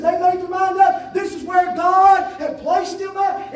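loud, slow preacher's shout; fiery preaching. Acted. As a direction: preach it out to the church. Preaching with force.

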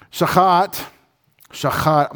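shachat said carefully with throaty ch; Hebrew word demonstrated. Shown as a demonstration. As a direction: sound out shachat distinctly, sha-KHAT. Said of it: "most Hebrew words accent the second syllable".

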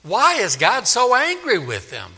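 stammering, high-pitched puzzled objection; baffled. Acted. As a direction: protest that you just don't understand. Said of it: "some people will read all this and say".

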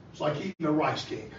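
ordinary delivery

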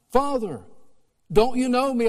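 high, loud cry of recognition; crying out to father. Retold or imitated. Son recognizing his father in the street, loud cry. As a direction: call out to a long-lost father. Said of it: "I asked".

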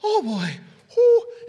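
put-on high, laughing oh boy then a loud whoo; overwhelmed groan. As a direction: sound swamped by the noise. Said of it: "and you're like".

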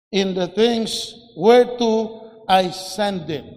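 loud, emphatic Bible verse reading; scripture reading. Acted. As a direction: proclaim the verse loudly, phrase by phrase. Reads the verse aloud to congregation.

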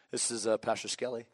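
low, soft, official pastor voice. Acted. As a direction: answer the phone as a composed pastor, voice dropped. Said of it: "put on my pastor voice".

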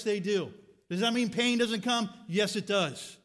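ordinary talk, nothing marked

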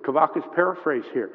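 ordinary talk

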